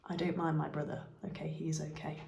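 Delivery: quick whispered aside to the viewers; hushed aside. Real, not acted. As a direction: whisper it as a confession. Aside about her real brother.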